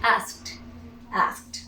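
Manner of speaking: "asked" as one syllable ending in T; final T sound. Shown as a demonstration. Teacher repeating the past tense for learners, final T sound. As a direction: close "Asked" on a voiceless T. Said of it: "we simply produce T".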